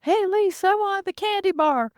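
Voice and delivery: put-on very high, loud toddler voice; whiny little kid. Childlike being begging Lisa for candy in the grocery store. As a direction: beg for candy like a small child. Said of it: "he'd be shuffling behind me".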